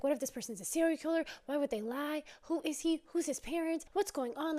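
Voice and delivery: high-pitched rattled questions; retold panic, questions tumbling out; worked up and pitched high; freaking out. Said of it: "she's freaking out".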